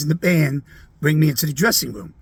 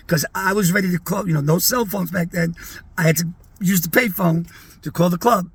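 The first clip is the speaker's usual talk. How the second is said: ordinary delivery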